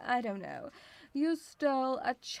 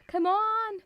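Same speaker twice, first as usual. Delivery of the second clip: higher, louder come on; exasperated; 8 semitones higher, 5 dB louder than usual. Real, not acted. Come on thrown higher and louder at chat. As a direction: Come on pushed up high and loud.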